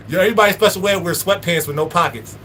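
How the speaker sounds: normal talking voice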